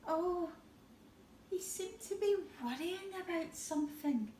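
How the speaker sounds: ordinary delivery, nothing marked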